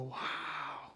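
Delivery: soft breathy wow of wonder; hushed amazed wow; awed hush. Retold or imitated. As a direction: marvel quietly at tiny fingers. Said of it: "And you just go".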